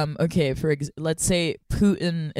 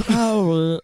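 louder throaty, drawn-out name; Severus Snape; 2 semitones higher, 6 dB louder than usual. Shown as a demonstration. Host trying the Snape impression, throaty. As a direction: push it out from the throat. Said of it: "This is a throatier impression".

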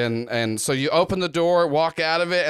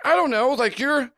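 put-on high teen character voice; high, uncertain girl's voice; Shifting Sammy puzzled; 8 semitones higher than usual. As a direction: pitch it up, confused and questioning.

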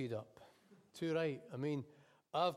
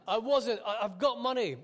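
higher, louder, stumbling protest; defensive passenger; 5 semitones higher, 7 dB louder than usual. Acted. Passenger protesting to the cabbie that she can pay, loud. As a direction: blurt it out, stung by the accusation.

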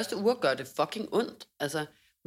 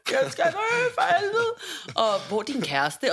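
much higher and louder, crying and laughing through the words; hysterical screaming; 12 semitones higher, 6 dB louder, slower than usual. Retold or imitated. Patient shrieks at the paramedics, hysterical laughing crying scream. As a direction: shriek in half-laughing panic. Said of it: "og jeg råber bare".

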